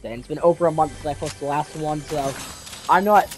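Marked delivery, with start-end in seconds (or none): none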